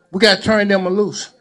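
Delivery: ordinary delivery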